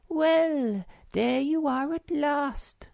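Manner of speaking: put-on high, slow, mildly reproachful girlish voice; Snork Maiden voice. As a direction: tease him for being late. Sister greets late brother.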